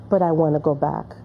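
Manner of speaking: normal talking voice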